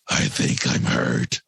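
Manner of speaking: low, slow, tearful Batman voice; a hurt kid whimpering in a low hero voice; crying Batman. Retold or imitated. Mom retelling her son hurt and crying as Batman, low and slow. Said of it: "he starts crying as Batman".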